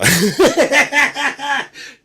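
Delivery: high, loud villain cackle; evil cackle. Acted. Evil laugh.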